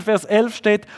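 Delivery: ordinary delivery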